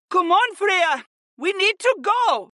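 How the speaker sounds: very high, pressing cry; urgent insistence. A narrator's reading. Syra to friend who won't move, insisting. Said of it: "Syra insisted".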